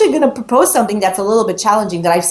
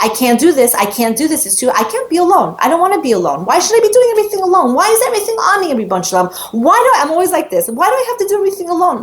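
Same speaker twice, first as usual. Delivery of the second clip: higher, faster, building whine; overwhelmed complaint; 8 semitones higher, faster than usual. Retold or imitated. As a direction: pile up the frustration till it cracks. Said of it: "I'm like".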